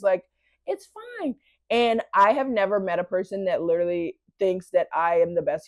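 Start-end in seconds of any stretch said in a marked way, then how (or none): 0.7-1.3 soft high reply. Retold or imitated. Quoting boyfriend's gentle reply.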